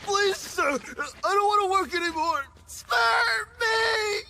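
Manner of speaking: high, tearful wail; desperate begging. Acted. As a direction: cry out for mercy from the boss. Worker begs boss for mercy.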